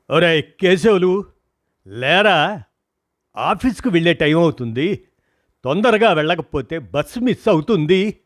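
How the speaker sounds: friend calling loudly to wake him. A narrator's reading. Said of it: "అని గట్టిగా అరిచి చెప్పాడు".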